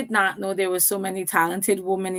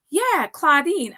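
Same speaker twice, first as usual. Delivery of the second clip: pitched much higher and slow, a light imitation; caller, slow and high; 7 semitones higher, slower than usual. The caller names who nominated her, higher and slow. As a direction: draw the name out sweetly, imitating the caller. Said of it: "And she said".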